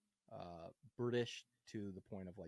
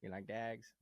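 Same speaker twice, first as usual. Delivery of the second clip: lightly imitated Pikey accent, "dags" for dogs; a hint of Mickey's Traveller accent; Irish Traveller accent.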